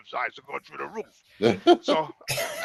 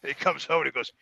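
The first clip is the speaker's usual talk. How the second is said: ordinary delivery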